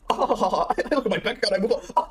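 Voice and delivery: slow, laughing repeat of the line. Real, not acted. Streamer repeating the guest's answer slowly while laughing. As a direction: chuckle the quote back, slowly.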